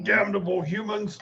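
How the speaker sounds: high-pitched in-character bellow. Acted. Wounded orc at the humans who shot him, bellowing. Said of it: "and kind of bellow".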